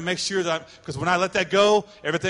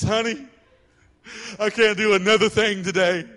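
hammed-up frazzled wife, louder and higher; stressed wife; 3 semitones higher, 6 dB louder than usual. Frazzled wife begs her husband to take the kids, hammed-up. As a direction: play an overwhelmed wife pleading. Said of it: "how many of you ladies".

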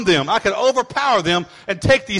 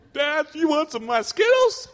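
put-on teasing kid; 7 semitones higher than usual. Kid teasing dad with candy.

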